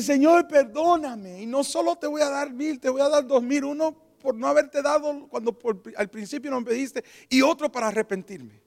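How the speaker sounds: high, loud, impassioned plea; tearful repentance. Retold or imitated. Penitent on the floor begging the Lord, tearful plea. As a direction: cry out for forgiveness, re-enacted. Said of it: "Yo me tiré de cara al suelo llorando".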